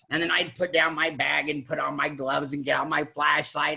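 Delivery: ordinary talk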